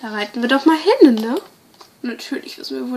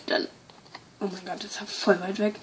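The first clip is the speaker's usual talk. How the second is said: ordinary talk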